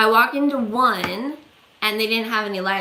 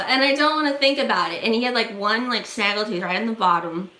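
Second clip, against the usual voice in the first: ordinary talk, nothing marked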